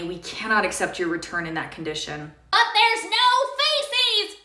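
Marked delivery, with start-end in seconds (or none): 2.5-4.4 much higher and slow, stretching it out; outraged insisting. Acted. Customer insists to cashier there is no feces, slow high outrage. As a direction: drag out the protest in disbelief.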